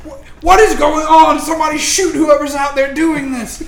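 frantic order yelled from the ground; panicked guard shouting. Acted. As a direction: shout for archers to fire. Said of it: "guards on the ground saying".

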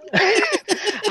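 short high-pitched laugh; high giggle. Real, not acted. Guest answering about childhood breaks off, high laugh.

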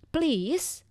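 a light put-on husband asking please; mock husband please. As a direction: echo him with a questioning rise. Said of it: "dia langsung ngomong".

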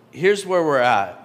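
ordinary delivery

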